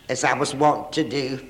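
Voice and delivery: normal talking voice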